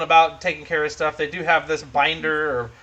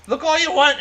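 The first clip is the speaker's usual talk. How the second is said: higher, louder dwarf voice; 10 semitones higher, 4 dB louder than usual. Acted. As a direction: play a dwarf calling out in a lifted pitch. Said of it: "says the dwarf".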